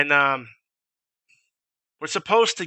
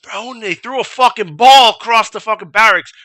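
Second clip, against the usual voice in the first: normal talking voice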